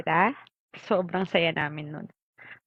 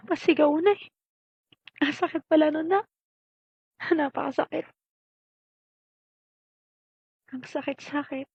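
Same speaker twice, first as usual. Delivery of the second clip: hurt pleading; 6 semitones higher than usual. Acted. Mother hurt that child changed.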